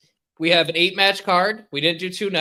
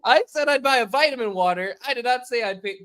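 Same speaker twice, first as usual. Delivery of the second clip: higher-pitched, indignant retelling; mock indignation; 7 semitones higher than usual. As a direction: act out a put-upon objection. Acts out objecting to the woman.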